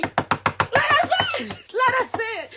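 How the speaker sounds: very high, slow, laughing plea; reliving the panic with a laugh; frantic pleading. Retold or imitated. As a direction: drag it out, high, half laughing. Begging to be let back in.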